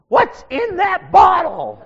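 put-on high, loud, slow creaky old man shout; old pastor voice. Imitating the ancient pastor in the front row, high slow shout. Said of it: "and he says".

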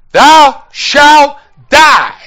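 slow, high, shouted pronouncement; thundering decree. Acted. Preacher quoting God's warning to Adam and Eve, thundering. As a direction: thunder each word separately.

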